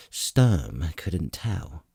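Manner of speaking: plain reading voice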